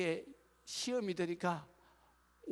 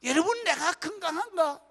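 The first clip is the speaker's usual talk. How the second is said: loud, high preaching to the congregation; full-force preaching; 11 semitones higher, 12 dB louder than usual. Acted. Pastor preaching loudly to the congregation, impassioned.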